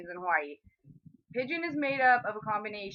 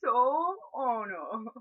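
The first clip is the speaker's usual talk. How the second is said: savoring food; much slower than usual. Acted. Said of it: "Broke the mouth".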